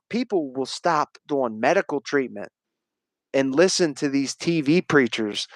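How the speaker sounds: ordinary talk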